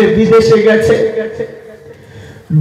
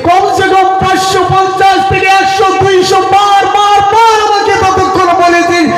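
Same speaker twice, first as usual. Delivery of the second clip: much higher, impassioned sermon build; preaching at full force; 10 semitones higher than usual. Acted. Preacher recounting the child's endless questions, much higher and impassioned. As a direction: cry it out to move the crowd.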